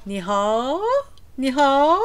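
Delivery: "hao" drawn out and sliding up; overdone long glide on "hao"; wrong rising tone. Shown as a demonstration. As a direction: stretch hao upward like an English question. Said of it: "You don't say".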